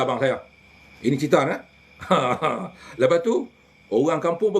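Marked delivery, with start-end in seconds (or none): 2.0-2.7 low chuckle; amused storyteller's laugh; short laugh. Real, not acted. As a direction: chuckle briefly at your own story. Storyteller laughs after retold threat.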